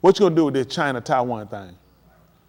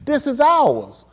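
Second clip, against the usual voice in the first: claiming the island; 10 semitones higher, 4 dB louder, much slower than usual. Retold or imitated. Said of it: "they're saying".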